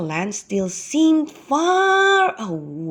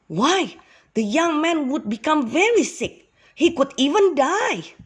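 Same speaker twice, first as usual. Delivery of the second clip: higher, worried storyteller voice; alarmed narration; 8 semitones higher than usual. A narrator's reading. Narrator telling children of the snakebite danger, alarmed. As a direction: raise the pitch with mounting alarm.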